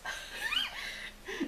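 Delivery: high, soft laugh. Real, not acted. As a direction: burst of helpless high laughter. Laughs at her mother's remark.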